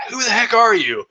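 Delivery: high, incredulous demand; indignant disbelief. Acted. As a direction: act out a baffled onlooker, pitch jumping up. Imagined onlookers react to the rant.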